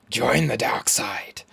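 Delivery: slow, whispered mock-villain invitation; sinister stage whisper. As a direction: whisper it slowly like a tempter. Mock recruiting to the dark side.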